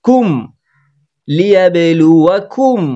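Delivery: slow recitation, a clean "m" ending কুম; clear izhar. Shown as a demonstration. Teacher modelling izhar for learners, clear "m" in কুম. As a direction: end লুয়াকুম on a distinct, un-nasalised "m". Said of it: "স্পষ্ট করতে হবে".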